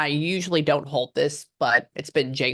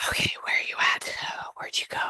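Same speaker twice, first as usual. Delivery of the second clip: whispered search; 6 dB quieter, faster than usual. Real, not acted. Psychic searching for a spirit.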